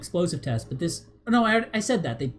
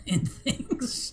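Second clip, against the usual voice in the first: laughing; 9 semitones higher, 5 dB quieter than usual. Real, not acted. Laughing at own remark.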